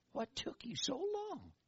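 high, slow little inner voice; a small high inner voice gently teasing; little tiny voice. Retold or imitated. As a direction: say it small, high and slowly drawn out. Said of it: "this little tiny voice inside".

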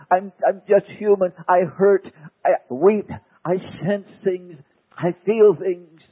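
rising into a loud anguished plea to the Father; pleading Jesus prayer. Acted. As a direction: cry out to God like a desperate man. Preacher voicing Jesus' anguished prayer.

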